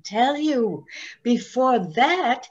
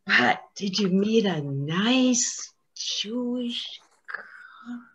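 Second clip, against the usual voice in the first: hushed question; 4 dB quieter, slower than usual. Real, not acted.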